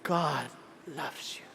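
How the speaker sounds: imitating the handsome pastor's soft low preaching; suave preacher. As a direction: croon it like a smooth preacher. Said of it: "pag preach".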